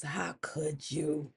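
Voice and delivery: very soft, slow, hurt question; troubled. Acted. Betrayed husband confronts his wife, deeply troubled. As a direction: let it out low and slowly. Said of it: "Orunmila looked troubled".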